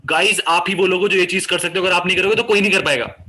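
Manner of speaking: fast, rushed and energetic; fast pace. Shown as a demonstration. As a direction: rattle it off quickly. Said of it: "मेरी pace fast होगी". (तेज़ रफ़्तार में)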